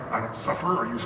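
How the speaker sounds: low, soft inner protest. Retold or imitated. Inner voice denying he suffers, low and soft. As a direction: mutter the denial to yourself, low. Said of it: "something inside me is like".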